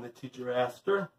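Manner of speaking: ordinary delivery, nothing marked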